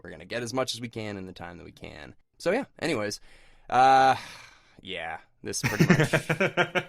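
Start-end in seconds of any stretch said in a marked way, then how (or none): 3.7-6.8 slow, deflated and resigned; dragged-out, defeated admission. Real, not acted. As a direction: let it out like you've given up. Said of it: "You sound so defeated".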